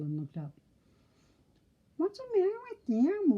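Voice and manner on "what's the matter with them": high, impatient piggy voice. A narrator's reading. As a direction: whine it like a restless little pig. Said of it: "said impatiently".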